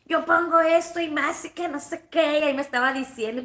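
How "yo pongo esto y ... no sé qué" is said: indignant mock rant, a bit louder and higher; mock indignant mom; imitating angry mom. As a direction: huff it out like an offended mother. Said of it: "Se enojó y dice".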